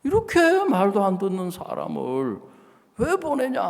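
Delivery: retold rebuke, voice pushed up high. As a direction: scold him with rising heat. Pastor retells scolding the husband.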